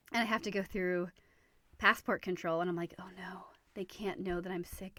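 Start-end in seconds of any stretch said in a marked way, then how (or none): 3.0-5.0 anxious whisper, hiding being sick; panicked whisper. Retold or imitated. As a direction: hush it so the officers won't hear. Sick at passport control, inner panic.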